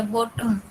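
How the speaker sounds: बॉटम softly, the second vowel barely sounded; very light final syllable. Shown as a demonstration. Teacher showing how light the sound is, बॉटम with a soft, reduced ending. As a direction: let the end of बॉटम fade into a light syllabic m. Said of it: "इसमें बिल्कुल हल्की सी sound आती है".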